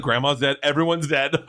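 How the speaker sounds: comically exasperated piling-on of bad news; hammed-up blunt reveal. Comic blunt reveal of deaths to kids.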